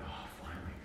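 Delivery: very quiet, breath-like sigh of relief; hushed, retold relief; relieved mutter. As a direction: say it under your breath, relieved. Relief at slow driver finally turning.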